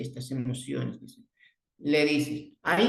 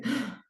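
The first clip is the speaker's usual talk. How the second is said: sudden very high startled gasp; hammed-up frightened gasp; mock fright gasp; 13 semitones higher, 4 dB quieter than usual. Shown as a demonstration. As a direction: act out a scared "ah" for a child. Demonstrating fright face to child.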